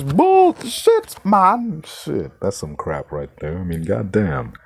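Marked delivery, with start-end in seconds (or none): 0.0-1.8 high, loud mock call of "Bullshit, man"; Nicholas. Quoting a friend's catchphrase to mock Rowling, high and loud. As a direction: belt it like a famous catchphrase. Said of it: "In the immortal words of Nicholas".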